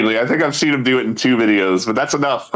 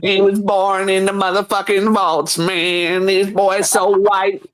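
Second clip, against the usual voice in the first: raised stand-up comedian impression; Cat Williams; 4 semitones higher, slower than usual. Comedian riffing on a pale vault boy, impression voice. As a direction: draw out the punchlines like a stand-up. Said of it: "He sounds like Cat Williams".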